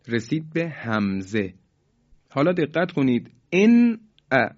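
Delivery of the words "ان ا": ان ا with the n sharply articulated; ان ا with no nasal hold on the n; clear izhar. Shown as a demonstration. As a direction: say ان ا, full n then separate hamza, high and loud.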